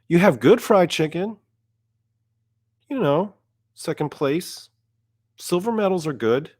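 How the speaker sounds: high-pitched, patronizing reassurance; condescending sing-song pat on the head; mock consolation. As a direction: console the loser as if a child. Consoling the losing Southern friends.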